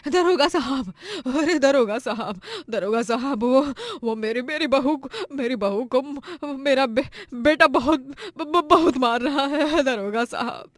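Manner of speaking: put-on breathless, stammering old mother; panicked plea. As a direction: beg the officer, stumbling over the words. Mother runs panicked to police.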